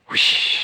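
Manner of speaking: breathy whoosh sound; whooshing magic sound. Acted. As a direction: blow a magic swoosh.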